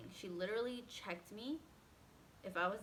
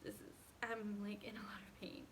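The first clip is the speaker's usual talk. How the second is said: pained whisper; 6 dB quieter than usual. Retold or imitated. In pain looking at husband.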